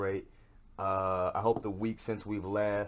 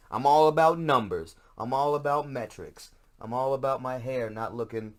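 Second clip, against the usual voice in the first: loud, high, hammed-up bragging; mock hype; 6 semitones higher, 10 dB louder than usual. Host mocking his own metrics talk, loud hammed-up brag. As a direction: play a numbers-obsessed influencer for laughs. Mock boasting about podcast numbers.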